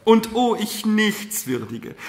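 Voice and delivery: loud, high, slow self-reproach; impassioned cry. A narrator's reading. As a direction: lament your own unworthiness aloud. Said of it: "rief sie".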